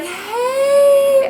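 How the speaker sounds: high, loud cheery hey; chirpy boyfriend. Retold or imitated. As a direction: greet brightly over the phone. Boyfriend calling at night.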